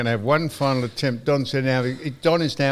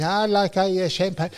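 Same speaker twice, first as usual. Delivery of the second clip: woman's voice; 7 semitones higher than usual. Acted. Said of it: "She says".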